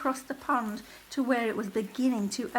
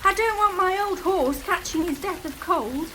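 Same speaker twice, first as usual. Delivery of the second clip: Matt shouting; 8 semitones higher, 6 dB louder than usual. A narrator's reading.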